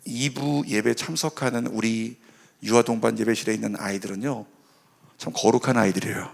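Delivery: ordinary talk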